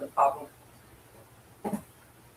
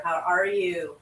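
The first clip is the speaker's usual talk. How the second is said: normal talking voice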